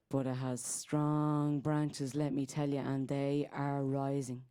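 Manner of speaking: low, slow poetic delivery; solemn incantation. Acted. Telling of strong rising branches, slow and solemn. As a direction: draw it out low and deliberate. Closes the poem.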